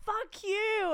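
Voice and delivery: high-pitched hammed-up swearing; re-enacting a tantrum at a partner; play-acted furious retort; mock angry outburst. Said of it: "I can't imagine you getting angry like that".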